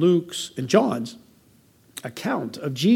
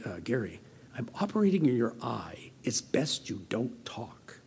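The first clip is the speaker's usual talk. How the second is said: very calm doctor; 6 semitones lower, 11 dB quieter than usual. Retold or imitated. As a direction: imitate a surgeon calmly hushing the patient. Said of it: "the doctor very calmly say".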